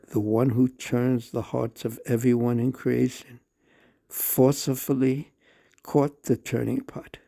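ordinary delivery

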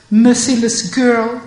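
reproachful scolding. Acted. Radha scolds Vishaka, loud and reproachful. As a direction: demand she remember.

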